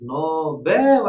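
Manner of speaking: "Nobel" with a loud, long final BÉL; loud no-BÉÉL for "Nobel"; stress on BEL. Shown as a demonstration. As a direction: stretch the last syllable of "Nobel". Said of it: "a sílaba tônica é a última".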